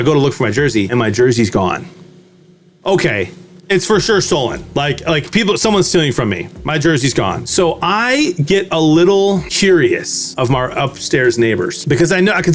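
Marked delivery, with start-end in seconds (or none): none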